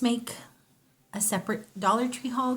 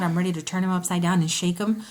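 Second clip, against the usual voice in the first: ordinary delivery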